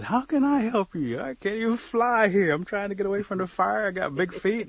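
high, loud, flustered bird; acted incredulous refusal; exasperated protest. Bird protests it cannot help.

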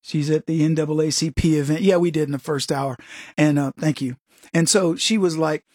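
normal talking voice

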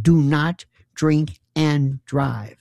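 very slow, word-by-word warning; mock stern warning. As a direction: spell it out as if to the slow-witted. Mock official warning, repeated.

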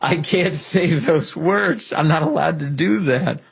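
slow, mocking imitation of the candidate; host mocks the candidate's own words slowly. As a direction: drag it out like you're mocking him. Mocking the candidate's leaked tape.

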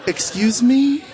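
high, startled "Excuse me?"; shocked "Excuse me?". Retold or imitated. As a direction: rise up in disbelief. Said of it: "I was like".

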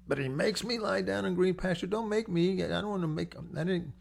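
high, whiny protest against being made; reluctant child. Retold or imitated. Preacher as a child resisting the Psalm, high whiny protest. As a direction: plead like a sulky kid.

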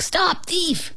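high barked command to the bug; eager dog calling stop; dog's bark. Acted. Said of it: "he barked".

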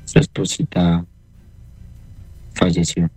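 slow, low and halting, retold; grave, subdued announcement; breaking bad news. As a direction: deliver the death gently, with a heavy pause. Retelling how death was announced.

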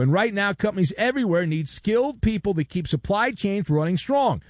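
radio ad read. Acted. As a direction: sell it like a radio host's promo. Host reads a sponsor ad.

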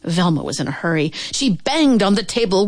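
usual speaking voice

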